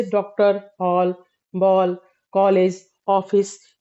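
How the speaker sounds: डॉक्टर, हॉल, बॉल, कॉलेज, ऑफिस with an open ऑ; English ऑ sound. Shown as a demonstration. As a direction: say each word slowly, with a rounded ऑ between आ and ओ. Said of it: "अंग्रेजी के शब्दों में ही मिलता है". (अंग्रेजी ऑ ध्वनि का उच्चारण)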